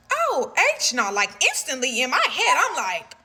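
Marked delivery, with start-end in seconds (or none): 0.1-1.1 shocked. Retold or imitated. Shocked inner reaction to bribe.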